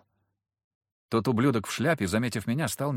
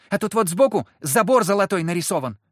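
high, loud piping voice; young child; 9 semitones higher, 7 dB louder than usual. A narrator's reading. Girl describes the carriage emblem, in a piping voice. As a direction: pipe up like an eager child.

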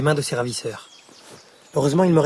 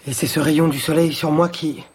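usual speaking voice

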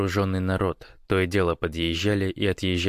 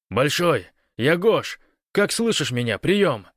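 raised, louder call into the radio; 8 semitones higher, 4 dB louder, slower than usual. A narrator's reading. Гош identifies himself to Большой, calling out loudly. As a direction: call out urgently over a bad link.

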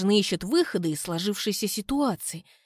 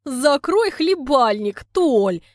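laughing; 5 semitones higher, 7 dB louder, much slower than usual. A narrator's reading. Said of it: "Смеётся Люда густым голосом".

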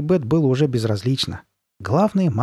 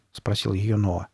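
usual speaking voice

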